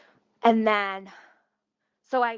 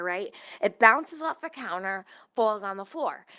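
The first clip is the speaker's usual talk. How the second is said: ordinary delivery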